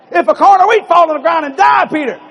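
high, loud shouted scripture; booming proclamation. Acted. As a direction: thunder it out like a prophetic pronouncement. Repeats Jesus's pronouncement to baffled Peter.

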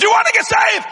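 forceful shouted challenge; shouted question. Retold or imitated. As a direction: bark it at the trembling man. Confronting a frightened man.

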